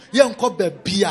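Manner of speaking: ordinary talk, nothing marked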